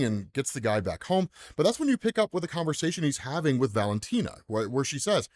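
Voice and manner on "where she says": ordinary talk, nothing marked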